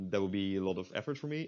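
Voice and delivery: usual speaking voice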